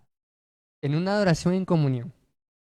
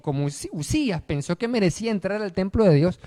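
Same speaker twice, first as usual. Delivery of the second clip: ordinary talk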